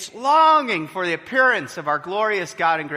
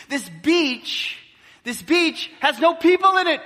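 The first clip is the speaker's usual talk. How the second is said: amazed disbelief; 9 semitones higher than usual. Real, not acted. Preacher marvels at empty beach.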